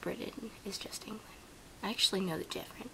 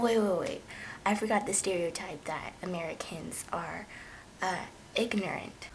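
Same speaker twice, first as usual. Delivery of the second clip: usual speaking voice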